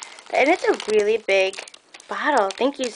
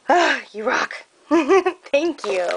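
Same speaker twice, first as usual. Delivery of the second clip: ordinary talk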